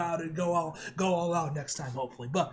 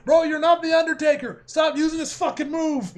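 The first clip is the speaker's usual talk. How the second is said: loud, raised-pitch fan rant; mock exasperated scolding; 6 semitones higher, 9 dB louder than usual. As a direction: tell the wrestler off, half-joking.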